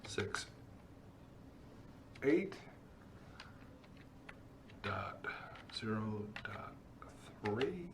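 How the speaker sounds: slow word-by-word dictation while typing; dictating. Real, not acted. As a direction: read the command out piece by piece. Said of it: "we're gonna type this command".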